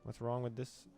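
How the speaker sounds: usual speaking voice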